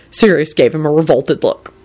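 usual speaking voice

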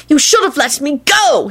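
high, mildly shouted protest; angry shout. A narrator's reading. Boy too angry to speak finally protesting, mild high shout. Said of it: "he finally shouted".

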